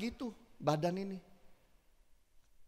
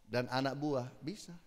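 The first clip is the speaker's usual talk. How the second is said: ordinary talk, nothing marked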